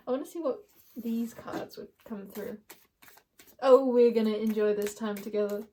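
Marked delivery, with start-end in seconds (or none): none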